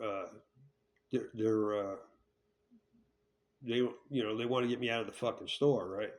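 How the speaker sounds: ordinary talk, nothing marked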